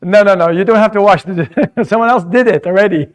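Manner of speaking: laughing. Real, not acted. Teacher amused by a student's answer, laughing as he corrects.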